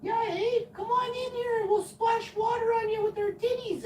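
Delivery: put-on squealy hot-tub flirts; giggly neighbour women. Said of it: "they're giggling, and they're like".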